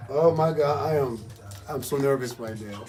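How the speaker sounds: normal talking voice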